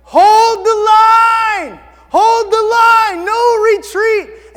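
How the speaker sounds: very high, very loud, drawn-out holler; rallying battle shout. Acted. Hero with the flag rallying his retreating army, drawn-out full shout. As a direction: bellow it slowly across a battlefield. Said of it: "and he's like".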